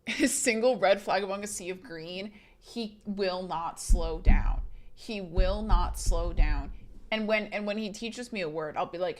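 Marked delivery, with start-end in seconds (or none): 2.6-6.7 slow, deliberate insistence; slow, emphatic. Real, not acted. Venting about boyfriend who won't slow down, slowly and emphatically. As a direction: lay out each word slowly for emphasis. Insisting on partner's stubborn refusal.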